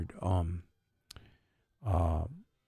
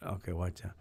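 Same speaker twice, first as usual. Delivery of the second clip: ordinary talk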